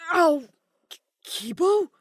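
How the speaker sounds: hurt astronaut startled; pained surprise. A narrator's reading. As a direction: yelp then stumble on the name. Kaito hit by arrow.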